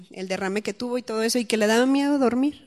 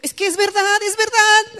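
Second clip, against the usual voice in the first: loud, high-pitched excited insistence; 11 semitones higher, 8 dB louder than usual. Retold or imitated. Speaker retelling her talk with the condominium children, excited. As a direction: burst out, thrilled it is true. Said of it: "así como estaba emocionada".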